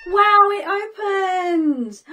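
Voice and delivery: loud drawn-out amazed wow; loud slow wow. Acted. Teacher exclaiming to children as the chest opens. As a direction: call out wonder, slowly.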